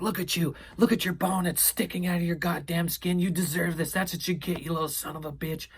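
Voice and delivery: high, mock-screamed scolding as mom; retold mom yelling. Mom scolding her injured kid at the hospital, high mock yelling. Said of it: "My mom's yelling at me, screaming".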